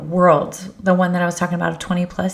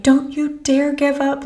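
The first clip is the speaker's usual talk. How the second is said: put-on higher, drawn-out New York-accented order; imitating New York accent; 8 semitones higher, slower than usual. Mentor urging her struggling friend on, imitated New York accent. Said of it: "like, New York accent".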